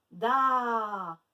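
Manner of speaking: DA loud with the a held long; stretched syllable. Shown as a demonstration. Teacher letting pupils hear the A, stretched syllable. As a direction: stretch Da into daaa. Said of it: "Où est-ce qu'on entend le A?".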